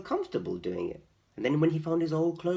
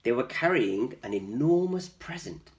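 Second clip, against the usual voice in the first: usual speaking voice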